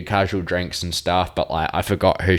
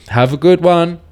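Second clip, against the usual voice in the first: much higher, louder sing-song goodbye; cheery farewell; 12 semitones higher, 13 dB louder than usual. Retold or imitated. Helpers leaving after pitching the tent, high cheery sign-off. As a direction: toss it off high and bright. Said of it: "they're just like".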